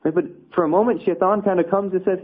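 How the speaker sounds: ordinary talk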